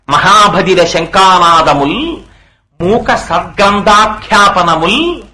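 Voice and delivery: slow, measured poem recitation; verse recitation. Acted. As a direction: recite each phrase slowly, drawing out the endings. (నెమ్మదిగా సాగదీస్తూ పద్య పఠనం)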